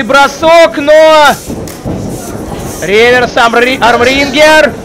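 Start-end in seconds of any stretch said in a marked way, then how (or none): none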